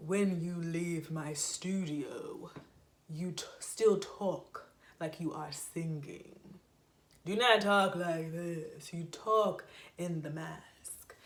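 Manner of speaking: slow, resonant singer's speaking voice; voice teacher in the mask. Retold or imitated. Voice teacher instructing her student, slow resonant mask voice. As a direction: speak it slowly, placed forward in the mask. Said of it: "She said".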